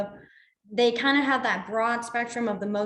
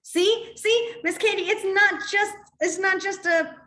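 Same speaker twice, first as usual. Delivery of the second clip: excited high pitch; 8 semitones higher, slower than usual. Real, not acted. Playful retort to commenter.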